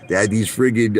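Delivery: usual speaking voice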